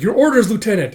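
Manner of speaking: loud, high call for orders; urgent soldier. Acted. Soldier demands orders in battle.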